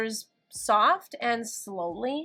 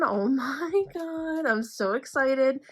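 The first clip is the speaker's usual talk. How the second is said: slow, high gasp of excitement; thrilled but scared to look; excited dread; 4 semitones higher, slower than usual. Real, not acted. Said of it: "and terrified that this".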